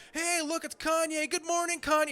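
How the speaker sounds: sing-song greeting to bait the star; mock paparazzi.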